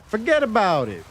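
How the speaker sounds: mock New York Italian accent, forget about it. Streamer complaining the map is from New York, in a mock New York accent. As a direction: dismiss it like a Brooklyn wiseguy. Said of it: "brought the New Yorker out of this".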